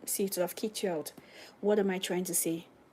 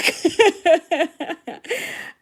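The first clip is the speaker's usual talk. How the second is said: loud laugh; 8 semitones higher, 9 dB louder than usual. Real, not acted. Laughing at chart fuss.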